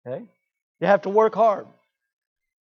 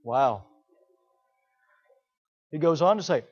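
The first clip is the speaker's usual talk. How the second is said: usual speaking voice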